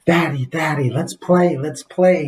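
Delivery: put-on a four-year-old pleading to play; mock excited kid voice; excited little boy. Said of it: "He was all excited".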